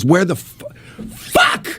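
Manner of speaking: high strained mock scream without volume; retold tearful screaming. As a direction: imitate screaming through tears, voice held back. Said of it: "he's screaming, and he's got tears".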